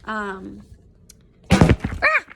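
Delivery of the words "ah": sudden high piercing shriek; real startled scream. Real, not acted. Teacher startled mid-lesson, a sudden high scream. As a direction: jolt and cry out for real. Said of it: "Man down".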